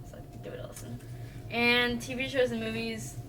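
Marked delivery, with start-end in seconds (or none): 0.1-1.0 unvoiced soft plug; whispering. Real, not acted. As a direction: whisper it like a secret. Whispered recommendation.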